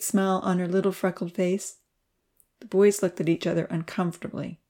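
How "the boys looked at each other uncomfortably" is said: plain reading voice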